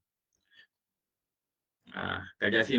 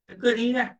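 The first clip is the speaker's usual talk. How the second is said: higher-pitched imitation of an evasive wife; mock coy wife; 9 semitones higher than usual. Wife making her husband guess why she cries, mock coy. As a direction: put on a high, teasing wife's reply. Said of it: "Tak ikutlah bini Rasulullah".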